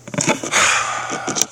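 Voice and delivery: long voiceless breath out; exasperated sigh. Retold or imitated. Husband whose wife says "What?", exasperated sigh. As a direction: let out a long, mock-patient sigh. Wife did not understand his apology.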